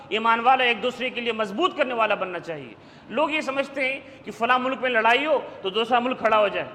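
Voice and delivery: ordinary talk, nothing marked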